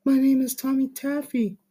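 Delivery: creepy Tommy voice. Acted. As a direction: play him as an unsettling smiling stranger. Tommy introduces himself.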